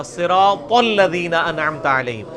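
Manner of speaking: صراط الذین slow with thick "tol", throaty 'ain after; correct recitation. Shown as a demonstration. Teacher recites the whole phrase properly, correct recitation. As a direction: draw out صراط الذین with heavy Saad and Taa. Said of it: "صراط الذین نہیں ہوگا".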